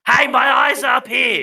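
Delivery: high, loud shout in character; indignant shout. Acted. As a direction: yell for their attention, mock-offended. Tree calls out indignantly.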